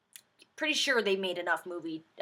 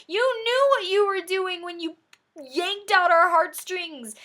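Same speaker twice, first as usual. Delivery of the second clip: mock accusation; 10 semitones higher, 3 dB louder than usual. Mock rant at the director.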